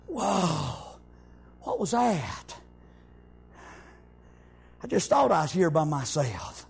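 low, hushed mock astonishment; John puzzled, voiced low with pauses; startled wonder. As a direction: act out being taken aback, voice dropped low.

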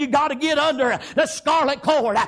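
high, loud, fervent sermon shout; full-force preaching. Acted. Preacher warning the people to get under the blood, shouting. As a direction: thunder the warning to the congregation.

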